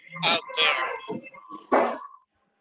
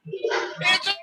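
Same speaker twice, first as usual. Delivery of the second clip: excited exclaim; 9 semitones higher, much slower than usual. Acted. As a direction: deliver the twist with a punch. Twilight Zone punchline echo.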